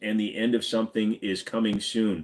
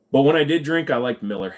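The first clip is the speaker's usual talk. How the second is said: ordinary delivery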